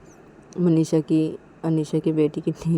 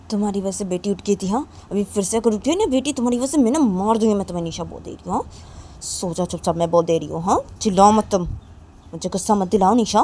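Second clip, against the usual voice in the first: angry threat; 3 semitones higher, 4 dB louder, faster than usual. Acted.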